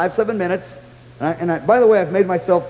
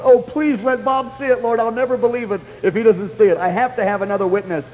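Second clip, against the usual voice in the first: pleading; 3 semitones higher than usual. Retold or imitated. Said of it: "I was pleading with the Lord".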